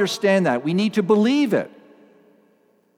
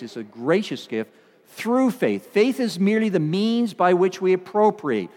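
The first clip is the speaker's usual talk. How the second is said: usual speaking voice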